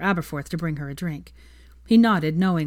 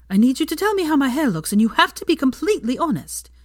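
put-on higher, urgent worried young woman; anxious Hermione; 9 semitones higher, 5 dB louder than usual. A narrator's reading. Hermione begs Draco to judge her hair, anxious. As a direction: plead for honesty, higher and louder.

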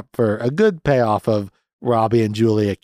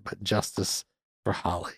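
much softer, under the breath; a quiet muttered aside; hushed aside; 3 semitones lower, 11 dB quieter than usual. Shown as a demonstration. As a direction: say it half to yourself, very soft. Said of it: "That's not my note yet".

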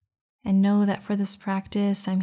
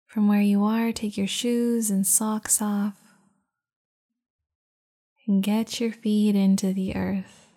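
guided meditation. Acted. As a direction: guide them into calm, unhurried. Leads a meditation.

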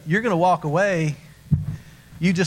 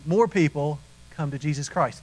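ordinary delivery

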